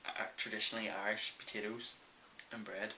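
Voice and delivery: ordinary talk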